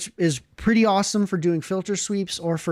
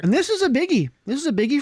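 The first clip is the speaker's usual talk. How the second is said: excited; 7 semitones higher, faster than usual. Real, not acted. Hyping the big pedal.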